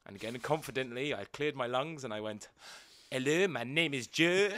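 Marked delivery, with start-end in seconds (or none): 3.1-4.5 slow, confident mock-French accent; fake French accent. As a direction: put on a child's badly faked French accent. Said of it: "Go on then, speak French".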